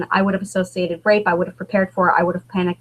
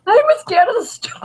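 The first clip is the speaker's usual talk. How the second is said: put-on higher, louder wail of a scared little girl; mock scared child; 13 semitones higher, 4 dB louder than usual. Daughter at bedtime wailing about the storm, high mock cry. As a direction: play the frightened kid calling out from bed.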